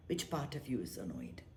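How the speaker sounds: whisper. Real, not acted. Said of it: "meditation teacher questioning softly".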